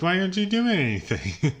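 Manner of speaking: high-pitched, exasperated protest; half-laughing disbelief at a useless character; mock incredulous question. Mock pleading with the movie character.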